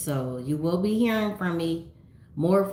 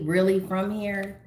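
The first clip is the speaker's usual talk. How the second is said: ordinary talk, nothing marked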